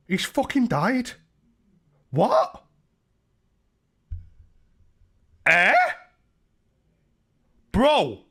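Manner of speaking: high, loud mock disbelief, hammed up; fake shock. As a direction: act stunned for the chat, shrieking high. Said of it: "I'm acting".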